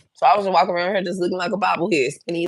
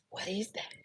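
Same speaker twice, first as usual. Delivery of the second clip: whispered disbelief; 4 semitones higher, 19 dB quieter than usual. Retold or imitated. Retold shock at the smell.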